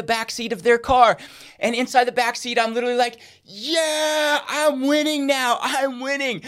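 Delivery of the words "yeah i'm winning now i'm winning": higher, slow gloating joy; happy. Retold or imitated. Dad inwardly celebrating in teammate's back seat, slow happy gloat. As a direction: savour the small win. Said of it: "I was so happy".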